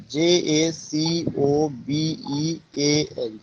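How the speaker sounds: "J A C O B E A N" read slowly as separate letters; slow letter-by-letter spelling for the class. Shown as a demonstration. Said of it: "जैकोबियन एज में था".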